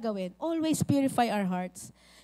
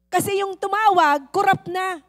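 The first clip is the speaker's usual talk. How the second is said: exasperated explanation; 5 semitones higher, 7 dB louder than usual. Acted. Pastor explaining, loudly amused.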